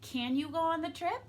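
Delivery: quick question, "trip" rising high; asking for an answer. Shown as a demonstration. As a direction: lift "trip" at the end to ask. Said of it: "I'm wanting an answer back".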